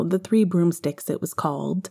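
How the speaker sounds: ordinary delivery, nothing marked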